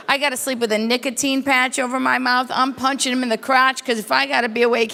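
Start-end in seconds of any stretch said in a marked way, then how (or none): none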